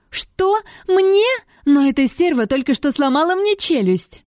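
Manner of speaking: high, outraged disbelief; incredulous protest. A narrator's reading. Narrator protests to the rector, high and indignant. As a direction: squawk back at an unfair order.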